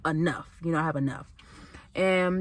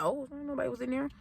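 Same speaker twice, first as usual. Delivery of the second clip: inner thought; 5 semitones higher, 10 dB quieter than usual. Retold or imitated. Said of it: "in my head, I'm like".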